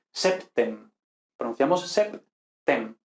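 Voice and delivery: septem with the P and T both sounded; both consonants clear. Shown as a demonstration. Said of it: "no simplificar los grupos consonánticos".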